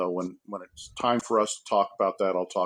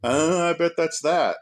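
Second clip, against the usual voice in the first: smug fan guess; 7 semitones higher, 4 dB louder than usual. Retold or imitated. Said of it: "make you go".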